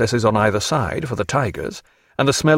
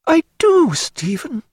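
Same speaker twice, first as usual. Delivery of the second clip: blushing cry; slower than usual. Acted. Diana answering Stephen's reminder of his proposal, impulsive high cry. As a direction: cry it out, then soften. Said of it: "cried Diana, blushing".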